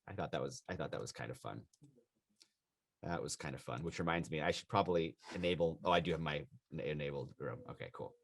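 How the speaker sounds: very quiet, low-pitched self-talk; talking to oneself. Real, not acted. Teacher checking the waiting room setting, quietly talking to oneself. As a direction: drop low and soft, as if thinking aloud.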